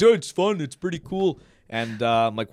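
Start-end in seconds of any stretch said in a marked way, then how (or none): 0.0-1.4 high, loud, casual friend impression; mock-chill hardcore friend voice; imitating the friends. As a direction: toss it off like it's nothing. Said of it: "that's how they sound".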